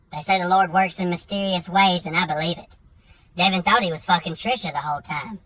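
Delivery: ordinary delivery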